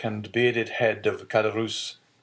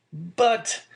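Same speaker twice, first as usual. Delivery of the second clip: higher startled interjection; mild cry of protest; 13 semitones higher than usual. A narrator's reading. Son shocked by father's debt, mild cry of protest. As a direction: blurt it out in a lifted pitch. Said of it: "cried Dante".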